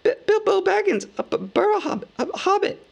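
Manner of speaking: very high, stammering hobbit voice, shaking with fear; terrified stammer. Acted. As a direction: stutter his name out, high and frightened. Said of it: "shaking all over".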